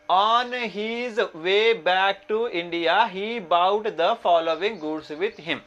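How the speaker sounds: slow, drawn-out reading aloud; question read-out. Acted. Teacher reads the exam problem to students, slow read-out. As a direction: read it out very slowly, each word stretched.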